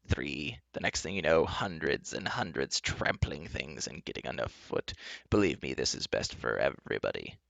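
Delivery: low, soft enchantress explaining patiently; reasoning enchantress. Acted. Enchantress explaining to Taryn, patiently reasonable. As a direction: justify it softly, voice sinking low. Enchantress justifies herself to captives.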